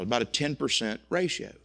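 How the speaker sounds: ordinary talk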